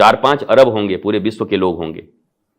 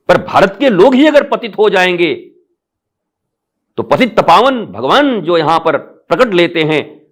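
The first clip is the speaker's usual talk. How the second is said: raised, higher-pitched sermon at full force; fiery preaching; 7 semitones higher than usual. Acted. Guru rousing Indians to awaken, fervent preaching. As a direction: preach it with rising fervour to the crowd.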